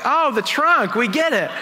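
high-pitched mock "now I get it"; sudden realization. Tourists seeing the driver open the trunk, sudden realization. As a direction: act out the penny dropping, voice jumping up.

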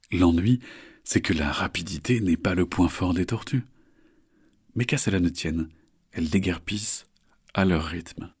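usual speaking voice

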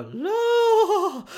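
comic high-pitched moan of pleasure; hammed-up squeal. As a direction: leap into falsetto and wail it. Mimes moaning as massage moves lower.

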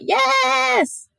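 a sudden, very high, squawked yes; piercing squawk of a cheer. Retold or imitated. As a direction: shriek it like a startled bird. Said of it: "Like a squawk".

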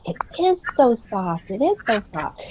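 put-on childlike client. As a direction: reassure mama like a small boy.

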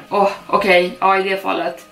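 ordinary delivery, nothing marked

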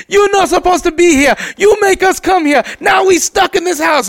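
put-on high, loud scolding kid sidekick; Short Round. Body part scolding its owner, squeaky sidekick voice. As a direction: nag in a squeaky accented child's voice. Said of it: "My dick talks to me like Short Round".

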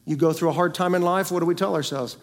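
ordinary delivery

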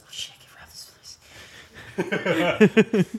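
whispered scheming. Acted. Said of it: "pulls out a gun and goes".

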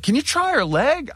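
pleading. Retold or imitated. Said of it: "And I was like".

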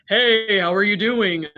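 tongue forward, sound like someone else. Shown as a demonstration. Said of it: "I sound like a completely different person".

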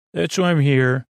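ordinary talk

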